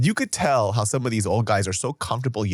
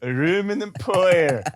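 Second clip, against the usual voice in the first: slow, higher Korean-accented "Aluminum foil"; 7 semitones higher than usual. Shown as a demonstration. Retrying the hard phrase, Korean-accented "Aluminum foil". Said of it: "say with a Korean accent".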